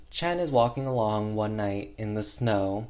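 usual speaking voice

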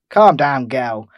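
loud, firm telling-off; loud retort. Retold or imitated. Pop telling the ranting woman to calm down, loud. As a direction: snap it to shut her up.

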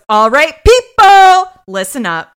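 loud, drawn-out captain's bellow; yelling. Retold or imitated. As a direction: yell to get the whole squad's attention. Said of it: "Cragen walks in yelling".

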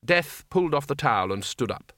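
ordinary delivery, nothing marked

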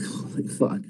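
hushed, voiceless retold curse; drained disbelief. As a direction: swear softly under the breath, worn out. Retold reaction to exhausting work.

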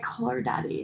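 hushed, seductive podcast-host imitation; Call Her Daddy style. Guest copying another podcast, hushed seductive voice. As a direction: drop the volume way down, sultry. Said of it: "I'm trying to be like".

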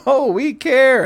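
hammed-up fake sincerity; mock voice. Mocking the Democrats' claim to viewers, high falsetto.

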